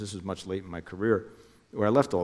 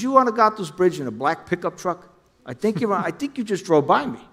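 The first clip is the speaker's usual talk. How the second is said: much higher, urgent voice for Jimmy; imitating Jimmy calling, pitched way up; Jimmy on the phone; 10 semitones higher, 7 dB louder than usual. Jimmy calls him on phone.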